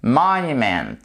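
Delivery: "monument" with the first syllable stressed, then falling away; teacher modelling "monument", stressed on MON. Shown as a demonstration. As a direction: stretch the MON of "monument", let the rest drop. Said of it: "l'accent tonique".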